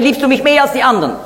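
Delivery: fast, high rattled-off question, mehr tossed off; quick aside quote. Retold or imitated. Er sagt nicht mehr.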